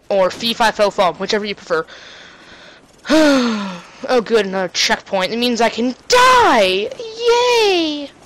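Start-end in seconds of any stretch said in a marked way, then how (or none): none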